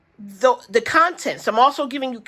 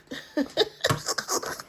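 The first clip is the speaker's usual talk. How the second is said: laugh; 5 semitones higher, 7 dB quieter than usual. Real, not acted. Laughing at her fear.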